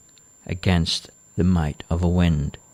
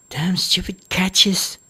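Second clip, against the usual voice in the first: annoyed daughter; 11 semitones higher, slower than usual. Acted. Daughter curses shutters.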